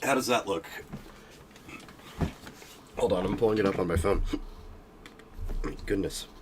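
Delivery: talking through hiccups; hiccuping between words. Real, not acted. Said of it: "I can't stop hiccuping".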